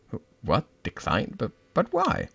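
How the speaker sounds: stammering disbelief rising high; can't believe it, voice jumps up; shocked. Acted. Hurt friend reacts to the refusal, stunned. Friend declines shared school.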